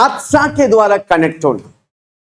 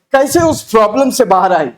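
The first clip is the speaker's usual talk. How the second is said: ordinary delivery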